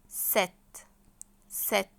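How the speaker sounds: "ست" as French "set", no P sound; silent letter. Shown as a demonstration. Teacher models number seven for students, "ست" as "set". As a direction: say "ست" with open E straight into T. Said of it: "وفي حرف مش هيتنطق".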